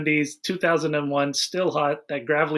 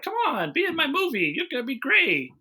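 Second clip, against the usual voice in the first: higher, coaxing imitation of a director; 10 semitones higher than usual. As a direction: sweet-talk her into the part. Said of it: "so he's just like".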